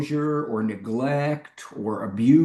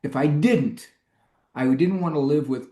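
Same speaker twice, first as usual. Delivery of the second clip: normal talking voice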